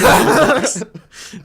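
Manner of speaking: loud helpless laugh; burst of laughter. Real, not acted. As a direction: crack up mid-sentence. Cracks up mid-reading.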